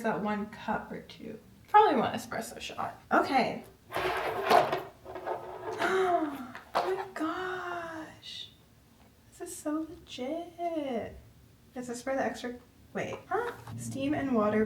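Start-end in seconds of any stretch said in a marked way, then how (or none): 3.9-5.8 gasp. Real, not acted. Sees the machine up close. 5.8-11.2 high, slow, softer awe; awed. Real, not acted. Admiring the fancy machine.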